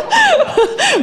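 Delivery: squealing laugh, high; high burst of laughter. Real, not acted. Audience volunteer rush.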